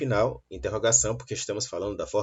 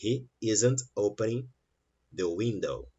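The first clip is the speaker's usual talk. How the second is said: quicker, words run together; quicker run-through. Shown as a demonstration. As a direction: say it in less time. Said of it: "Você consegue falar uma frase com menos tempo".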